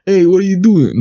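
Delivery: loud, high mock Boston voice; Boston accent. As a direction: throw on a bad Boston accent. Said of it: "the Boston accent especially".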